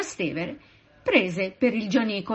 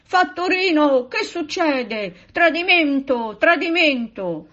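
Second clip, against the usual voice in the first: loud, high cry of outrage; alarmed shout; 8 semitones higher, 6 dB louder than usual. A narrator's reading. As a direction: raise the alarm as an indignant passenger. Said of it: "si mise a gridare".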